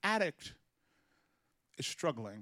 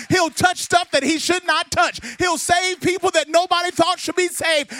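high, loud building to a sung roar; full-force preaching; 9 semitones higher, 12 dB louder than usual. Acted. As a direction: whoop it up for the glory.

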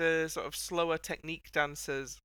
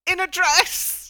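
delighted high shout, slow; excited shout; 13 semitones higher, 11 dB louder, slower than usual. Real, not acted. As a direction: cry out in delight at the dress. Excited reveal of dress.

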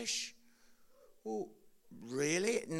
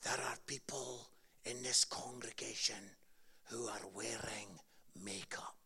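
much lower and softer, ending in a whisper; whispering preacher; 9 semitones lower, 10 dB quieter than usual. Retold or imitated. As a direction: lean in and whisper the accusation. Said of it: "And he says".

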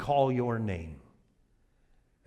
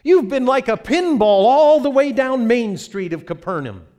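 exasperated disciple; 12 semitones higher, 8 dB louder than usual. Acted. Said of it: "the disciple looks at Jesus".

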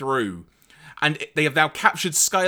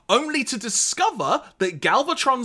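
usual speaking voice

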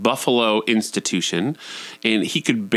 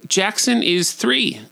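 ordinary talk, nothing marked

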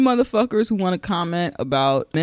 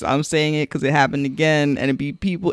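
usual speaking voice

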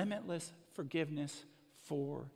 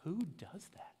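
mock disbelief; 7 semitones higher, 3 dB quieter than usual. Mock outrage at the boss.

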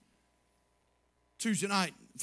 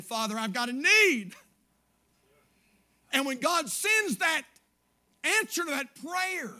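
rising, louder preacher's testimony; preaching; 4 semitones higher, 5 dB louder than usual. Acted. Pastor testifying to his church, building to a fervent cry. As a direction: build it up for the congregation.

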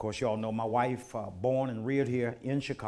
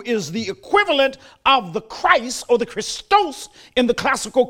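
rising, measured pulpit cadence with punched words; full preaching; 12 semitones higher, 13 dB louder than usual. Acted. Pastor preaching the gospel to his congregation, fervent. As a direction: preach it, drawing out the big phrases.

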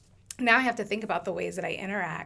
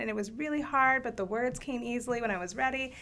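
ordinary talk, nothing marked

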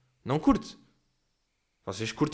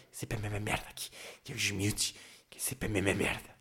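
very soft, low imitated grumble; muttering to herself; 7 semitones lower, 12 dB quieter than usual. Neighbour complaining as she sweeps hard, muttering to herself. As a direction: grumble away under your breath, low and hushed. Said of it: "como está meio a comentar".

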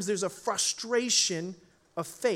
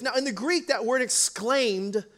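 ordinary delivery

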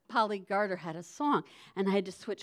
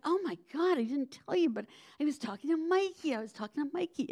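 put-on high, breathless girlish gossip; excited gossip; 7 semitones higher than usual. Young girl doing her hair, gushing gossip. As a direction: spill the news eagerly.

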